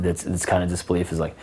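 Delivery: usual speaking voice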